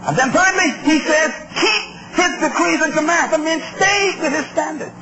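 high, emphatic scripture exposition; forceful preaching. Acted. Preacher teaching David's charge to his son, forceful preaching. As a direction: preach each instruction with stretched emphasis. Expounds scripture at full pitch.

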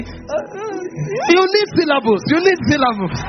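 fervent preaching. Real, not acted. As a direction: drive the point home to the congregation. Preaches at full force.

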